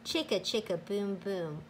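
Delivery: ordinary delivery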